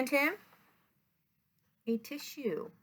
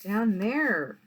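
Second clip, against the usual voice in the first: usual speaking voice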